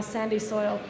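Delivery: ordinary talk, nothing marked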